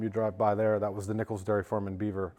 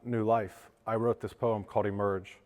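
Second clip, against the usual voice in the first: normal talking voice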